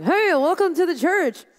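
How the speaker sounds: high, loud, slow fake cheer; mock cheesy greeting. Mocking stiff announcers with a high, loud, slow fake welcome. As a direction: ham up a forced, empty welcome. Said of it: "They weren't like".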